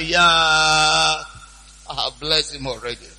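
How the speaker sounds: put-on low, drawn-out old father's groan; acted low-voiced patriarch; father's reply. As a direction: answer deep and heavy, trailing into softness. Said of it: "The father said".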